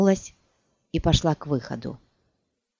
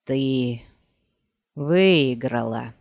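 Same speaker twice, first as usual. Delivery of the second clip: slow, hoarse dying rasp; hoarse wheeze; 3 semitones lower, much slower than usual. Acted. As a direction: force it out with the last breath. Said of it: "прохрипел он". (хриплый медленный хрип умирающего)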